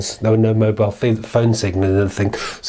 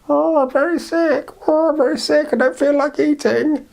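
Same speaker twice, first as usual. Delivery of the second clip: sick granny voice. Acted. The girl imagines her sick granny, high and frail. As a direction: whimper like a poorly grandmother.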